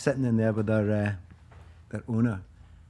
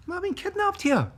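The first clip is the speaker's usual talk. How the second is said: mock alarm. Retold alarmed question to owner.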